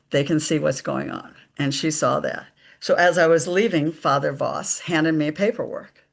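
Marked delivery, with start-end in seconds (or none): none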